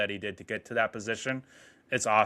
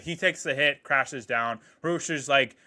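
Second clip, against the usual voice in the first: ordinary talk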